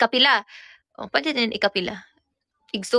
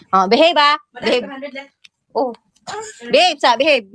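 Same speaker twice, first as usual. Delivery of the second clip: loud, higher call to behave; loud warning; 4 semitones higher, 7 dB louder than usual. Real, not acted. Telling child to behave.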